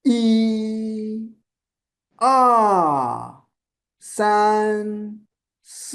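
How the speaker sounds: each of "E, R, san, si" held through a full arc; rainbow, full circle. Shown as a demonstration. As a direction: stretch E, R, san, si high and long. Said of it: "all are rainbow. Full circle".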